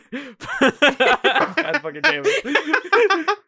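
cursing through laughter; laughing exasperated groan. Real, not acted.

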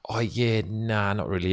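usual speaking voice

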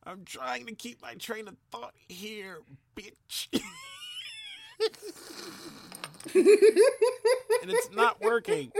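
laughing through the words; giggling, can't stop laughing. Real, not acted. Laughing while losing train of thought.

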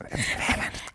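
whispered aside. Real, not acted. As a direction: breathe it as a secret. Joke denial.

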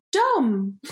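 put-on high little-kid announcement of Dom; imitating proud toddler. Mum imitates daughter pulling up at home, toddler voice. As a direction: announce it like a small child. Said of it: "she went".

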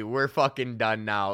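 ordinary talk

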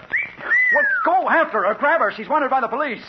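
high, loud frantic order; urgent shouting. Acted. Reporter orders window washer after a wanted girl, urgent shout. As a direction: shout the command across the gap. Urging washer to chase suspect.

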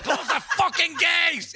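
high, loud, drawn-out retold yell; shouting. Storyteller imitating the farmer yelling at him over escaping sheep, shouting. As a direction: imitate a farmer bellowing an order across a field. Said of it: "He's shouting at me".

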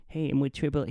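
high-pitched, poorly faked squeaky man's voice; Mike Tyson. Triple A guy calling in, high-pitched bad impression. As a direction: attempt a terrible high celebrity impression. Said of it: "I can't do a Mike Tyson impression".

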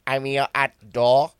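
Bolton delivery driver voice; Bolton accent. Retold or imitated. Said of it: "That's a Bolton accent, that".

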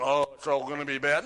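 quick, loud imitation of a doom-saying voice; hammed-up naysayer, rushed and loud; mock pessimism. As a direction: toss off the bad news, mockingly. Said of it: "It wasn't".

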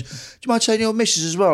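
a lightly voiced builder explaining drilling work outside. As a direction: chat to the homeowner like a friendly builder. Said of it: "He goes".

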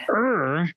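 a sharp, high frustrated growl at a stuck jar lid; a quick burst of frustration, half-mocking yourself. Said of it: "And that".

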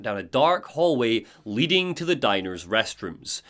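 usual speaking voice

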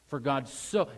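loud, lifted "so" left hanging; stress on so. Shown as a demonstration. Preacher urging listeners to emphasize the word, "so" stressed and rising. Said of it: "emphasize so in your mind".

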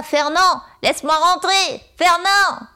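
high, overplayed working-class street girl; street girl caricature. Shown as a demonstration. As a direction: ham up the poor street girl of the period. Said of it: "fille des rues qui parle comme ça".

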